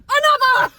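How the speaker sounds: mock shout. Voicing friend demanding more.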